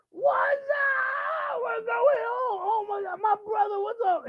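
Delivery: put-on high, loud drunken holler; wasted rocker. Wasted guy greeting a supposed brother, high slurry shout. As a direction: shriek like a wasted long-lost friend. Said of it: "and he's wasted".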